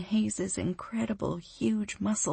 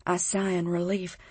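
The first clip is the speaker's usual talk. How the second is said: ordinary delivery, nothing marked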